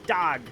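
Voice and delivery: "Dog" as "dahg"; "Dog" said low, with an "ah"; vowel as in cot. Shown as a demonstration. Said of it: "dog like the vowel in cot".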